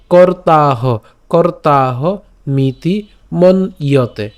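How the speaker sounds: মন ইয়তে slowly split, mon-iyote; slow deliberate recitation; slow careful pronunciation. Shown as a demonstration. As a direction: drag out কর্তাহ মিতি মন ইয়তে. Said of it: "ইয়তে কেন".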